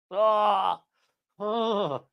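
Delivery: high, drawn-out "oh" groans; pained groan. Real, not acted. Reacting to the Massachusetts accent, pained high groans. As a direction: groan in dismay, high and long.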